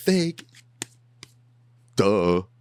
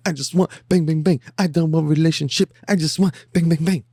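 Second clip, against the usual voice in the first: higher, louder hammed-up woman's voice; mock female voice; 10 semitones higher, 4 dB louder than usual. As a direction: ham up her demand, pitched high. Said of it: "she's like".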